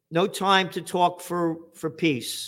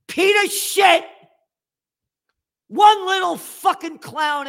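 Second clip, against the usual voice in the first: much higher, louder shouted insult; angry shout; 13 semitones higher, 6 dB louder than usual. Real, not acted. Host yells a mocking insult at a politician, angry shout. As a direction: bellow the insult.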